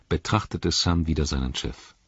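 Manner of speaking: ordinary delivery, nothing marked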